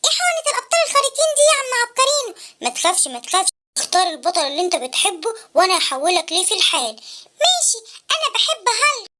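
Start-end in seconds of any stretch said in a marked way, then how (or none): 2.6-6.9 put-on much lower, gentle old man; wise old inventor voice. Uncle Abqarino asking the sad boy why, low. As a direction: ask like a kindly old uncle.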